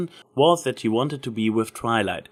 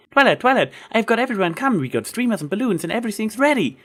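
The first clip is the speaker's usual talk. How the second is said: high, loud, bouncing rush; excited Pinkie; 12 semitones higher, 4 dB louder than usual. Acted. Pinkie bounds up to Twilight with party news, high and loud. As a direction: burst in bursting with news.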